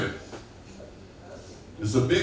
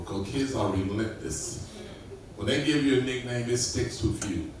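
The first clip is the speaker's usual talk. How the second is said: ordinary talk, nothing marked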